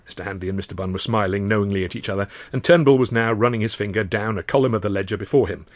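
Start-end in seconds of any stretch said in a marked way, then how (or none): none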